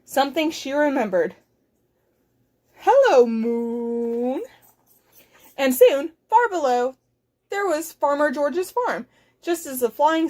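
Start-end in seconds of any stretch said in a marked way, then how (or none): 2.8-4.4 slow, long drawn-out moon; mooing cow voice. Acted. Lost cow greets the moon, slow mooing. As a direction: stretch it into a moo.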